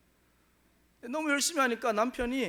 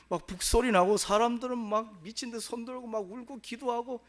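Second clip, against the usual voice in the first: ordinary delivery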